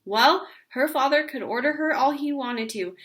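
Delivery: plain reading voice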